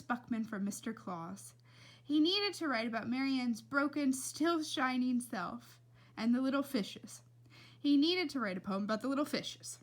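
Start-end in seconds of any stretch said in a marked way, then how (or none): none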